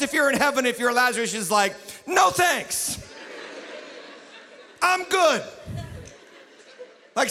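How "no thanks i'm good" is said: high-pitched, hammed-up polite refusal; comic refusal. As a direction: turn down the invitation, playing it for laughs. Lazarus in heaven declining the call.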